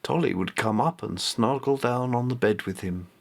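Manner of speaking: usual speaking voice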